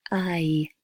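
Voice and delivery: whispered I; price vowel. Shown as a demonstration. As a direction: breathe out the I of price. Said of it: "the I sound for price".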